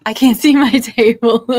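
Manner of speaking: high-energy words through laughter; real laughing. Real, not acted. Host cracking up at a viewer's table comment, laughing. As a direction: say it loudly while cracking up.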